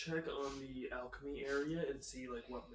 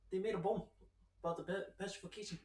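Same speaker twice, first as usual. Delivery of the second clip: put-on Kiwi accent; 6 semitones higher than usual. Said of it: "that word is hard to say in a Kiwi accent".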